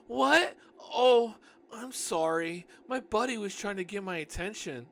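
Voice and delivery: high, loud startled excuse; flustered apology. Acted. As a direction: jump as if caught, then apologize. Narrator caught out, flustered apology.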